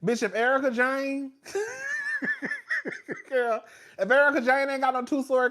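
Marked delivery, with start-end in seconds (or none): 1.4-3.2 sudden high shrieking burst of laughter. Real, not acted. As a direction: crack up in a high squeal. Laughing at own Erica Jane joke.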